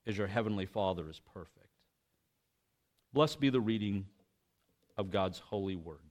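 measured, solemn reading of scripture; formal Bible reading voice. Acted.

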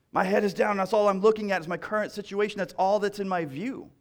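rising sermon intensity, pressing on; impassioned preaching. Acted. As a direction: preach it like the pain is right there. Preaching at full force.

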